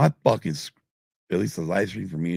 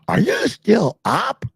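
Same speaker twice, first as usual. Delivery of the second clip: high, slow, quizzical wife voice; sleepy wife's question; 5 dB louder, slower than usual. Retold or imitated. Wife waking to find him still up, high and slow. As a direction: voice Kim catching him awake late. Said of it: "she'd be like".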